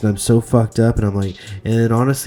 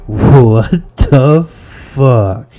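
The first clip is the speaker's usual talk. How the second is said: very drawn out, much louder shocked what the fuck; shocked stranger; 3 semitones higher, 9 dB louder, much slower than usual. Retold or imitated. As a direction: stretch out a stranger's stunned outburst. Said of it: "somebody walking in and just being like".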